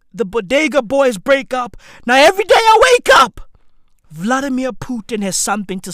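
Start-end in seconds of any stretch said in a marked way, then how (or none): 2.1-3.3 loud, high and very fast outburst; exasperated shout. Acted. Comedian ranting about waking up to news, exasperated shout. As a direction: burst into a rapid, high-pitched yell.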